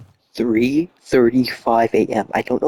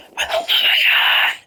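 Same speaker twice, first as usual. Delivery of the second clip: slow, desperate whispered yell; hushed frustrated shout. Real, not acted. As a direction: scream it under your breath. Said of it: "shout whisper".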